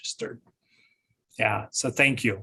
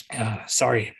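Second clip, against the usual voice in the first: hoarse, voice giving out; strained hoarse voice losing strength. Real, not acted. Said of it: "I'm losing my voice".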